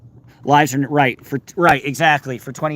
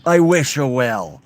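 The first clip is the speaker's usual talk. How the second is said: slow, drawn-out mock politician voice; slow, emphatic parroted well-wishing; Trump; 2 semitones higher, 5 dB louder, slower than usual. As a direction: stretch each word out, loud and deliberate. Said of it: "You're like Trump".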